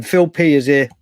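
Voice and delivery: normal talking voice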